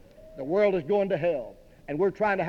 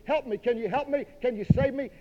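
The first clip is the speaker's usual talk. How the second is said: high, urgent imitated pleas; mock screaming for help; 7 semitones higher than usual. Preacher voices drowning people calling up to the ship, mock scream. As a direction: cry out high as the drowning crowd. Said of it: "People all around us screaming up to us".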